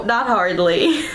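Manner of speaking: slow words with a laugh through them. Real, not acted. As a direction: chuckle the answer out, amused. Answers whether father is bald.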